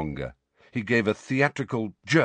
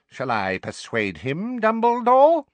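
slow, then high stern call; a menacing old witch; 14 semitones higher, 4 dB louder than usual. A narrator's reading. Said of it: "called the gimlet-eyed witch".

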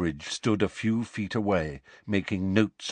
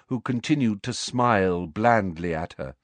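ordinary delivery, nothing marked

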